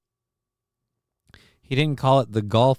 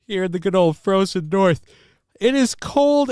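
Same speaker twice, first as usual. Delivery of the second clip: mock shivering complaint; 8 semitones higher, 4 dB louder than usual. Exaggerated complaint about cold.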